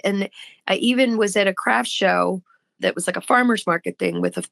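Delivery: ordinary talk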